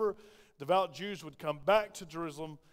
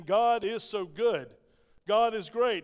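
loud, higher declaration of praise; ringing out praise to God; exclaimed praise; 3 semitones higher, 8 dB louder, much slower than usual. Acted. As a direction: proclaim it slowly and loudly, punching each phrase. Congregation's praise voiced within the sermon.

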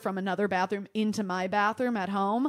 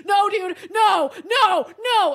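loud high panicked pleading; 10 semitones higher, 9 dB louder, slower than usual. Retold or imitated. Retelling overflowing toilet panic, loud desperate no. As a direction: beg the toilet to stop, voice climbing.

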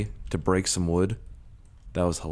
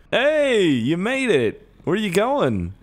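excited calling out; 10 semitones higher, slower than usual. Pretend. Calling out to arriving bus.

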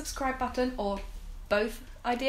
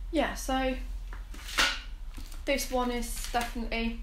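talking with food in mouth; speaking between bites; chewing while talking; 2 semitones higher than usual. Real, not acted. Seen 1: Bites chocolate at -3, jaw and lip chewing motions through +4.9.

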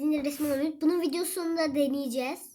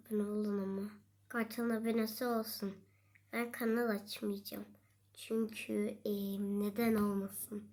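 whispering; 6 semitones lower, 9 dB quieter than usual. Real, not acted. Secret aside to viewers.

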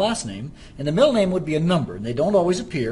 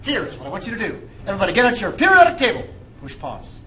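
higher pitched hard-sell pitch; slick used car salesman; 6 semitones higher than usual. Pretend. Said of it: "Do I sound like a car salesman?".

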